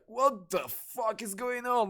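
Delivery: high, slow, stunned; shocked disbelief. Retold or imitated. A fan stunned to see Tyler walk out, shocked and slow. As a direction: drag it out in total disbelief.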